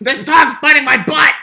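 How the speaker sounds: high, loud panicked yell. Pretend. Acted panicked shout.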